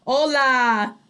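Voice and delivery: ordinary talk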